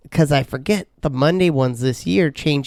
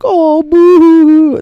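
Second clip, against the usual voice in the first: high loud mock pity; mocking office ladies; 10 dB louder than usual. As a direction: tease him like the ladies. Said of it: "they're like".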